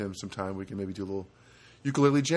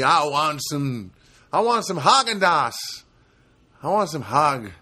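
whiny craving voice; 2 semitones higher, 3 dB louder than usual. Retold or imitated. Voicing craving for ice cream.

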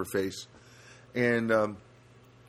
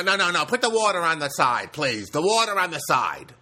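mock high bossy bagger voice, louder; 4 semitones higher, 4 dB louder than usual. Imitating the bagger insisting on paper bags, mock bossy. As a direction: playfully imitate her ordering him around.

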